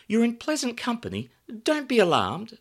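high, bright welcoming hostess; glamorous hostess voice. Acted. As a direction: greet guests like a bombshell hostess. Samantha hostess voice.

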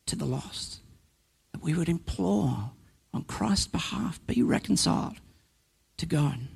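quiet earnest closing plea; hushed appeal. Real, not acted. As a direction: bring it down to a near whisper.

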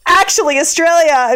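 high, broad Australian reply; Australian accent. Retold or imitated. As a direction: switch proudly into Australian. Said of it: "in, like, an Australian accent".

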